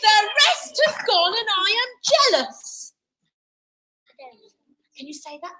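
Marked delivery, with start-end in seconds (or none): 0.0-2.9 high, fast complaint dropping away; jealous tantrum. A narrator's reading. Cinderella left behind by the rest, jealous complaint. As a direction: fire off a high grievance, then drop.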